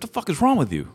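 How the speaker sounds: high-pitched, exasperated imitation of an angry friend; indignant confrontation. Sandy friend running up to the car, indignant. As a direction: act out a buddy's outraged demand.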